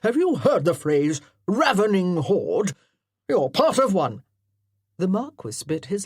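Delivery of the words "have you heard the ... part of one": loud parade-ground colonel; commanding colonel. Acted. Colonel directing his amateur actors, loudly commanding. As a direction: bark orders like a drill officer.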